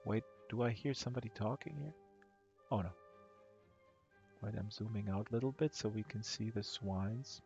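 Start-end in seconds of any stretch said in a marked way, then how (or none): none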